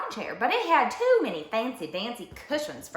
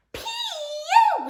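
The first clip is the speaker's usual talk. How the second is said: very high, loud squeak of disgust; squeaky bear cub; 6 dB louder than usual. A narrator's reading. Bear cub smells Dusty Locks, squeaking. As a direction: squeal it out as at a stink. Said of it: "squeaked the bear cub".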